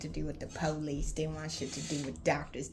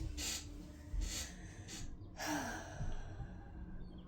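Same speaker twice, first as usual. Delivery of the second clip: long sigh; 3 semitones lower, 11 dB quieter than usual. Real, not acted. After near-death memory.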